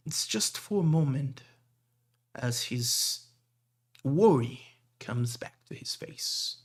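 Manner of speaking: ordinary talk, nothing marked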